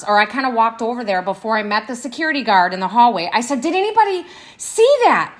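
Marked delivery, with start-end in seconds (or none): 3.6-5.3 high-pitched, incredulous question; retold disbelief. Woman asking neighboring offices after getting out, in disbelief. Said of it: "because I couldn't believe it".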